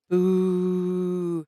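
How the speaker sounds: a long, drawn-out crowd boo; booing noise. Pretend. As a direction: boo like a disappointed audience. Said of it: "insert the boo noises".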